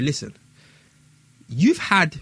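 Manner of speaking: ordinary talk, nothing marked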